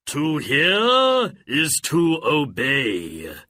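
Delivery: slow, loud, obedient intoning; booming genie. Acted. Genie obeying Aladdin's command, booming. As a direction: stretch it out like a solemn vow.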